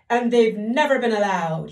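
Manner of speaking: loud sobbing king voice. Acted. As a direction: sob it out, miserable. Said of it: "he sobbed".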